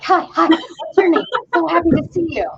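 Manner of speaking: high, bouncy happy dog voice; eager Labrador voice. Retold or imitated. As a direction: gush like an overexcited puppy. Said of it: "a Labrador retriever".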